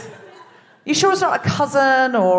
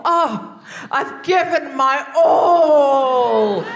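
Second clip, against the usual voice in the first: mock passionate cry; 3 semitones higher, 3 dB louder, much slower than usual. Mock dramatic declaration.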